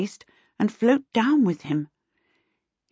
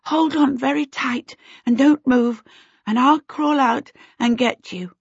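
anxious; 7 semitones higher than usual. A narrator's reading. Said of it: "Charmaine panted anxiously".

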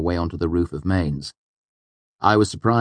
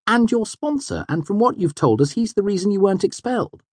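high-pitched, reasoning friend; tactful friend. A narrator's reading. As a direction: remind him tactfully, pitched well up. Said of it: "Sim responded tactfully".

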